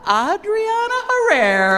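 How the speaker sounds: slow, high, loud guest announcement; announcer call. Real, not acted. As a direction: call the name out big.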